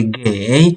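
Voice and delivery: 给 said with the third tone, falling then rising; dipping tone. Shown as a demonstration. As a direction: let 给 dip low and rise. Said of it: "falling and rising tone".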